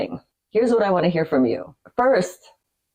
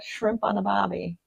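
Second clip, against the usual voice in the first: softer, halting go at an Aussie accent; attempted Australian accent; 4 semitones higher, 5 dB quieter than usual. Shown as a demonstration. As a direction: fumble for the Aussie sound. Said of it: "it's so bad".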